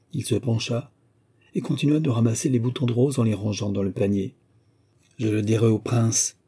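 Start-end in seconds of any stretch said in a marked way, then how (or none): none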